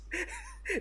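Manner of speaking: short, very high giggle; real high giggle. Real, not acted. Reviewer cracking up at the scene, giggle. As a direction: let a giggle slip out. Laughing at own joke.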